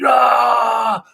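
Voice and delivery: sharp high cry of cramp pain, replayed; a loud high "Ah!" mimicking leg cramp pain; retold scream. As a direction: yelp it out like the cramp just hit. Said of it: "you're screaming 'cause it hurts".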